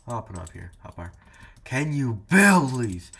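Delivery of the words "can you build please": loud, slow, higher whine; loud plea. Real, not acted. Begging the camping opponent to build, loud slow whine. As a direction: drag it out, begging loudly.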